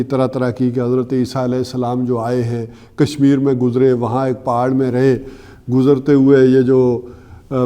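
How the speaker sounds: normal talking voice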